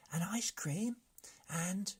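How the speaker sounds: breathy high whisper; hushed, excited addition; whispered longing for ice cream; eager whisper. Acted. Brigand adds in whisper.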